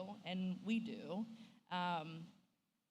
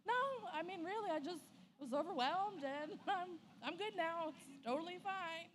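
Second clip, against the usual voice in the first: fake fine; 10 semitones higher than usual. Reassures husband after crying.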